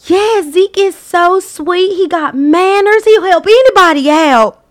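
loud, high, drawn-out country gushing; imitated country accent. Daughter chiming in about Zeke's manners, loud country accent. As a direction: imitate a gushing country girl, vowels stretched. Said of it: "that replicated accent from Iva".

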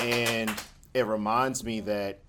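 ordinary talk, nothing marked

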